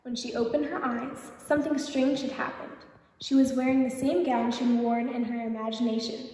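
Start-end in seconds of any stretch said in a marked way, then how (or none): none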